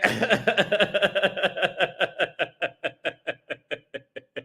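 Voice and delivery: long rapid-fire cackling laugh; drawn-out staccato laughter. Real, not acted. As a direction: keep the laugh rattling on until it fades. Laughing at viewers' comments.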